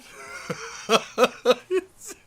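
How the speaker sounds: high staccato laughing fit; helpless giggles. Real, not acted. Player unable to stop laughing at the game, giggling. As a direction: giggle on with no control. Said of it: "I got the giggles now".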